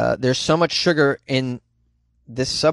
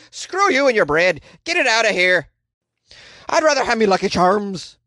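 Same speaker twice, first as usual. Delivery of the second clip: lightly hammed Irish brogue, higher and a bit louder; mock Irish voice; 6 semitones higher, 3 dB louder than usual. Vlogger voices Ireland rejecting American bread, mock Irish voice. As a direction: Ireland personified, telling off the bread. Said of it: "Ireland is like".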